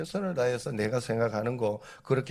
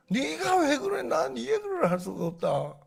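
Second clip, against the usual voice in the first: high, loud mock exasperation; exasperated disbelief; 5 dB louder than usual. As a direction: throw up your hands, voice climbing. Exasperated quote in political dispute.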